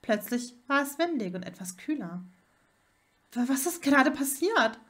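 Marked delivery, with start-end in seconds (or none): 3.3-4.8 stammering, higher, louder alarm; startled after the sudden jump; a bit panicky. A narrator's reading. Hermine asks Viktor what just happened, a bit panicky. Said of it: "fragte sie etwas panisch".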